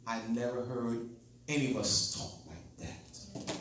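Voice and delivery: ordinary talk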